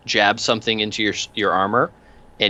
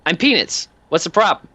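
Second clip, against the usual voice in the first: much higher, cheery odd little thug; much higher goofy henchman voice; Peanuts voice; 12 semitones higher than usual. Acted. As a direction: chirp the question brightly. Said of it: "stops and goes".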